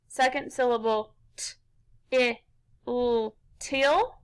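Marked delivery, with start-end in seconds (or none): 1.4-4.2 "t, i, l" as isolated short sounds, then "till" whole; sounded out. Shown as a demonstration. Teacher tapping along with pupils on the second syllable, sounded out. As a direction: tap out "t, i, l" one by one, then blend to "till". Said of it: "Tap 'em out with me".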